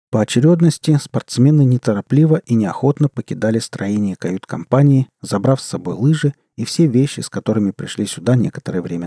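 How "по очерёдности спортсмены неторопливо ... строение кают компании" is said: ordinary delivery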